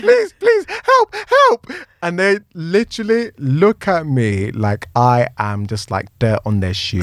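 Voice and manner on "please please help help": very high, frantic comic begging; mock desperate pleading. Man knocking on police car door, mock desperate pleading. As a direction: beg in a squealed, hammed-up panic.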